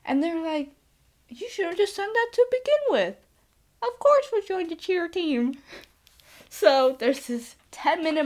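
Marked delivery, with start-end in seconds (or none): 1.3-5.6 mock exasperation. Clubs voiced agreeing, exasperated.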